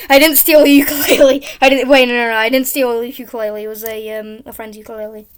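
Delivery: ordinary talk, nothing marked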